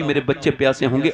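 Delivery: ordinary delivery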